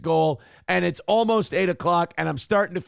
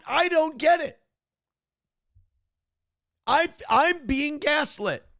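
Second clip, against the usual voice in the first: incredulous outrage; 9 semitones higher than usual. Real, not acted. Streamer reacting to viewer saying it worked, high incredulous outburst.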